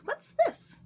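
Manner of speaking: very high, loud surprised squeal. Acted. Witch spotting something beneath the bed, high surprised squeal.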